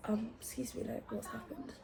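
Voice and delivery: very soft, almost whispered ask; hushed nosy question. Retold or imitated. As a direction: lean in and whisper it. Nosy approach to crying woman.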